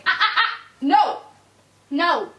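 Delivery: high loud warning ah ah ah. Real, not acted. Owner warning puppy off, loud ah-ah-ah no. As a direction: stop him now.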